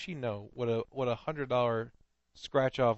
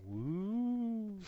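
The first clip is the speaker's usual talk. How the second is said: high, hooty woo with arms up; lightly spooky ghost call; mock ghost woo; 10 semitones higher than usual. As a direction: hoot like a rowdy drunk playing ghost. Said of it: "That's an actual quote".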